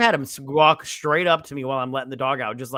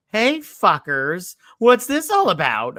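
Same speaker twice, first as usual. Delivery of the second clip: raised, higher-pitched indignant call; mock outrage, louder toward the end; pretend confrontation; 6 semitones higher, 5 dB louder, slower than usual. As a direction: call them out, stretching the opening words. Imagined confrontation over missing bonus.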